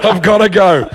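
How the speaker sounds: high mock-urgent sign-off; flustered exit. Comedian ends the snatched phone call, high and flustered. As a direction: get off the call in acted haste.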